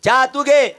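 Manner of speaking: loud, high, scornful retort; rude moneylender. Acted. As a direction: snap back with contempt. Lender rudely refuses. (सावकाराचा तुच्छ मोठा आवाज)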